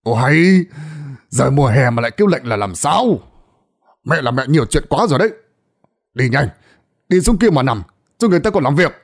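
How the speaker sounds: loud, exasperated son snapping at his mother; out of patience, bark at her; loud scolding. A narrator's reading. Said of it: "Gã nói lớn".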